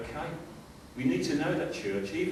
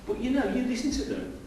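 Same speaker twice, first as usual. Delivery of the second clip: usual speaking voice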